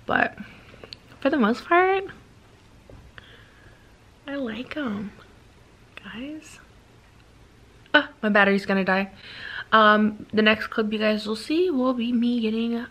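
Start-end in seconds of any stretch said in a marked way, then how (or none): 4.3-6.6 whispering. Real, not acted. Soft aside to viewers.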